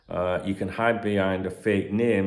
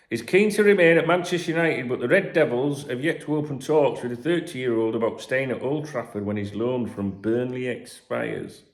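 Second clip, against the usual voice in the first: loud, high gossip-column read; news read; 4 semitones higher, 5 dB louder than usual. Acted. Podcaster reading transfer rumours to listeners, news-read style.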